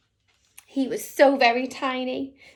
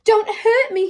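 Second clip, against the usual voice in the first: a scared plea, higher and louder; frightened whimper; 7 semitones higher, 9 dB louder than usual. Acted. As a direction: beg not to be hurt. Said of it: "he whimpered".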